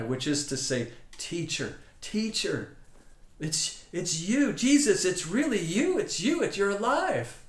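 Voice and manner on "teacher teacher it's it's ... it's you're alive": overjoyed. Acted. Mary recognizes the risen Jesus.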